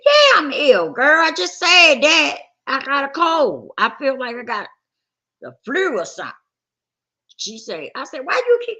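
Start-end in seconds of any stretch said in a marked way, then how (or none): 0.0-6.3 louder, higher, exasperated retelling of her own answer; mock yell. Telling her homegirl she's sick, a retold loud protest. 8.2-8.8 higher, louder, fed-up cut-off question; exasperated. Retold or imitated. Fed up with her friend repeating herself, a retold high snap.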